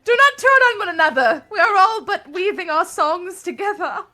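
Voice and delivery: high, loud hammed-up appeal; mock-earnest plea. Player begs fighting chat for unity, mock-dramatic. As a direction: plead grandly through laughter.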